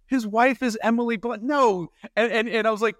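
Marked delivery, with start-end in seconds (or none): none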